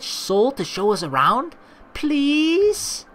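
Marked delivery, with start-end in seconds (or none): 1.9-3.1 Please? pitched high with a long begging rise; pleading please. Acted. Begging her companion to help the boy, a high rising Please?. Pleading with Retona to hire Sol.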